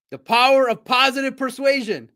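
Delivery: power, positive, persuasion each with a hard breathy P; loud slogan, P in power, positive, persuasion aspirated; three popped Ps. Shown as a demonstration. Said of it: "Three Ps".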